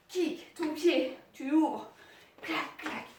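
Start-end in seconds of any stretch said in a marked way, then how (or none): none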